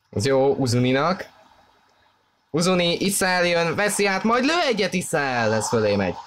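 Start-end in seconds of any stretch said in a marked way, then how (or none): 2.5-5.5 excited hungarian sports commentary. Real, not acted. Attack building, live commentary.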